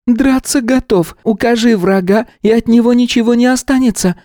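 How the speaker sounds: proud little fighter reporting; eager golem. A narrator's reading. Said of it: "бойко заявил хорёк". (бойко, голосом голема)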